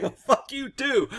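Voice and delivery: high-pitched heated comeback; retold cursing back. Cop answering the cursing suspect, mock-angry. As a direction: fire the insult back.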